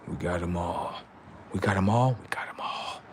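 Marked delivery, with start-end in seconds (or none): 2.2-3.0 breathy whispered repeat; eerie whisper. Acted. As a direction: whisper it to yourself. Unvoiced repeat.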